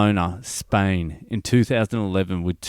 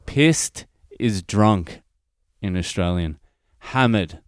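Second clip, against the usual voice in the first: slow explaining; slower than usual. Shown as a demonstration. Host explaining slang slowly to international listeners. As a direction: spell out "pissed is drunk" very slowly. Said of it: "for our international listeners".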